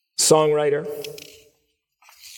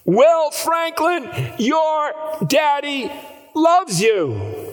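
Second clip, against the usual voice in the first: very high, slow, loud cry; crying out even higher; 12 semitones higher, 3 dB louder, much slower than usual. Retold or imitated. Father calling again to his son, crying out even higher. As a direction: cry it out again, straining harder. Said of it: "he cried out".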